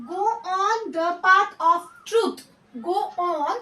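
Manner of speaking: very slow, word by word for pupils; each word drawn out so children can copy; slow dictation. Shown as a demonstration. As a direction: spell it out slowly like a teacher dictating. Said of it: "ह्याला आपण इंग्लिशमध्ये कसं म्हणायचं आहे बघा".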